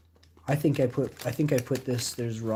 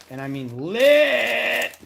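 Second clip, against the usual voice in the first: drawn out, higher and louder; emphatic; 11 semitones higher, 7 dB louder, much slower than usual. Real, not acted. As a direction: lean hard into every word. Emphasising after "I get lit.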